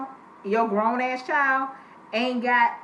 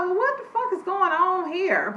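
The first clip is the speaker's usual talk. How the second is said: shocked reaction; 8 semitones higher than usual. Retold or imitated. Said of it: "I was like".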